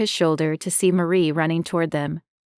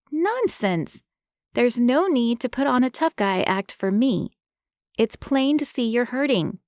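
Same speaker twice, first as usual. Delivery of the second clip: firm woman voice; 6 semitones higher than usual. Acted. Marie scolds.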